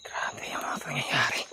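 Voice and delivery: slow breathy whisper; whispering while hiding. Real, not acted. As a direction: whisper it slowly, unvoiced. Alone in dark forest.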